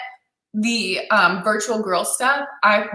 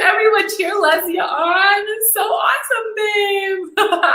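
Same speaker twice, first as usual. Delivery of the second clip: excited cheering; 11 semitones higher, slower than usual. Real, not acted. Host cheering Leslie on before her viewers, tearful excited cheer.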